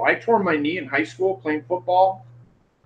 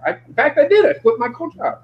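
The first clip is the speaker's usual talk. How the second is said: ordinary delivery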